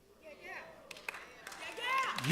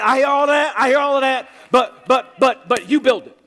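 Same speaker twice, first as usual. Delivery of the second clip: firm command; 4 semitones higher, 5 dB louder than usual. Retold or imitated. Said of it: "God said".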